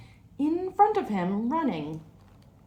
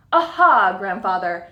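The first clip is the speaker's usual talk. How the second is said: triumphant shout; 12 dB louder than usual. A narrator's reading. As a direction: crow it out as he wins. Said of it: "Asban shouted".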